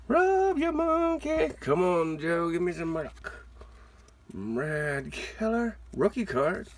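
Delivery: usual speaking voice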